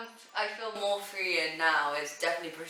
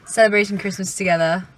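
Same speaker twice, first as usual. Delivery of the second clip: excited; 4 semitones higher, 11 dB louder than usual. Real, not acted. Driving to pick up parents.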